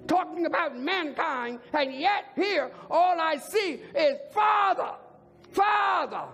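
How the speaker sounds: high, ringing pulpit proclamation; full-force preaching. Real, not acted. Preacher driving the count home to the congregation, high and forceful. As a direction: hammer each repeated word, high then falling.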